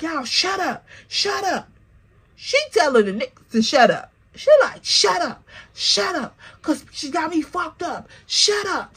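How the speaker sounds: high, insistent mock hush; high hushing snap. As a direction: shut up, pitched high and insistent. Friend hushing the men.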